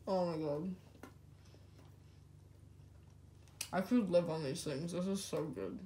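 chewing while speaking; muffled through food; talking with mouth full. Real, not acted. Seen 0: Bites sandwich at -2, chews full mouth, sandwich held at lips throughout.

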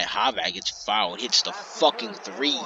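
ordinary delivery

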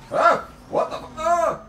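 high, loud cry of shock; jolted as the car spins out; startled cry. Real, not acted. Car crashes during race.

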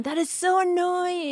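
whiny high-pitched. Retold or imitated. Mother mimicking her kids' annoyed complaint, whiny and high. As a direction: whine it out like a sulky kid. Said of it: "this kinda whiny, high-pitched tone".

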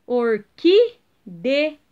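"orquídea" very slow in separate syllables, loud "quí"; antepenultimate stress. Shown as a demonstration. Teacher showing pupils the third-last syllable, stressing "quí" in slow "orquídea". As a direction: break up "orquídea", punching "quí". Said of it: "Então, é a antepenúltima".

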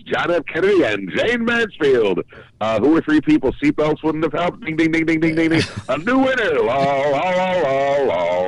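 perky, hammed-up game show patter; perky game-show bit. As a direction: play a chirpy quiz show with sung fanfare. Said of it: "how you can be this perky".

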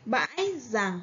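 bãi with a clear ngã tone, rằng with ăn rhyme ending ng; bãi rằng said carefully; reading along. Shown as a demonstration. Teacher reads it again with pupils. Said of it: "Các bạn hãy đọc lại cùng cô".

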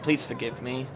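low, soft, meek apology; mock-sincere apology. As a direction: apologise gently to the stranger. Apologising to game character.

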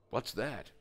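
ordinary delivery

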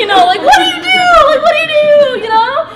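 very high, loud mock shriek; comic panic. Overwhelmed on hearing of the cancer, panicking comically. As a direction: squeal it out as if desperate to flee.

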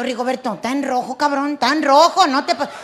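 loud alarmed warning. Retold or imitated. Wife warns her nervous husband at a red light, shouting. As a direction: yell it's a red light.

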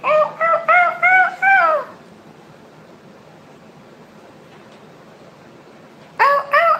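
high sing-song "ow ow" howling like a puppy; playful high-pitched puppy howls; mock dog howl.

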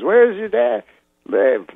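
lightly voiced Cosby asking; Bill Cosby. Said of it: "He goes".

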